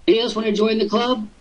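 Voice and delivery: ordinary talk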